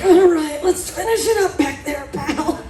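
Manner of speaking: high, loud coach pep talk; gym bro encouragement. Retold or imitated. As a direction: pump yourself up like a gym buddy. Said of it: "And you're like".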